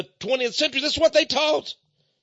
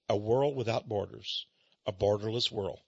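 muttered disgust; 13 semitones lower, 12 dB quieter than usual. Retold or imitated. Host echoing the book's phrase in quiet disgust. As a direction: repeat it low and quiet, in disbelief.

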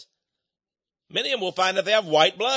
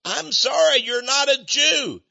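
emphatic shout; 7 semitones higher than usual. Real, not acted. Preacher rebutting the man who bugs him, shouting. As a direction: hammer the denial home, slow.

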